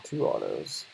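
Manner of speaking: normal talking voice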